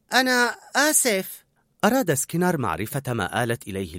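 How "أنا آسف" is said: slow, high-pitched, embarrassed; sheepish apology. A narrator's reading. Cook to shocked colleague, sheepish apology. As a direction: apologise slowly and shyly. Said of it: "يعتذر لها بخجل".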